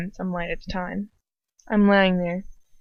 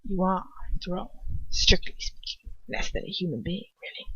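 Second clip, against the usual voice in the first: much softer, fading contempt; murmur; 7 dB quieter than usual. Acted. Lucius sneering softly at a mudblood, murmured. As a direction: let it die to a hush. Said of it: "Lucius murmurs".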